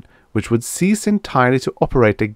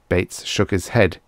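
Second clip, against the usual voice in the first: ordinary delivery, nothing marked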